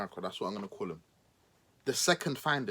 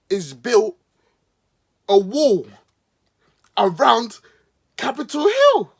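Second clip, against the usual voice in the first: mock outrage; 6 semitones higher, 10 dB louder, slower than usual. Sarcastic rant building up.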